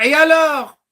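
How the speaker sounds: high, loud dismissive "so what?"; impatient mocking snap; scornful retort. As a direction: brush the complaint off hard.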